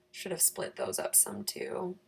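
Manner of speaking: normal talking voice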